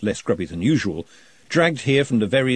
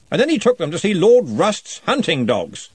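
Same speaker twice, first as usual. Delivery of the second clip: put-on higher and louder, hearty old wizard; jovial lordly wizard; booming Arch-Chancellor; 8 semitones higher, 5 dB louder than usual. A narrator's reading.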